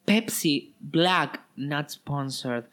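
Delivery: very slow mock product plug; slow ad read. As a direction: drag out the brand name.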